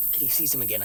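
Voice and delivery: normal talking voice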